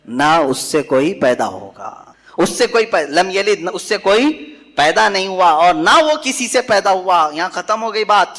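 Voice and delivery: usual speaking voice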